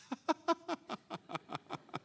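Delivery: long, high put-on laugh; forced demonstration laugh. Shown as a demonstration. Wife coming home to husband, put-on laughter. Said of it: "무조건 웃어야 돼".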